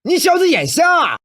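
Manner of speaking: loud, high outburst at a stranger; angry shout. Acted. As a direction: yell it, outraged at being bumped into. Bumped, yells at the man.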